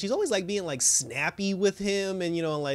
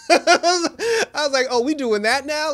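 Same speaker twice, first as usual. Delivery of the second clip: high, loud, laugh-filled words; laughing in disbelief; 12 semitones higher, 9 dB louder, slower than usual. Real, not acted. Viewer reacting to a marital fight on the show, laughing in disbelief. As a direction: crack up while reacting to the insult. Cracks up at the insult.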